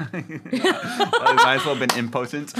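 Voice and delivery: slow, laughing confession. Real, not acted. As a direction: laugh through the line. Joking about being impotent, laughing.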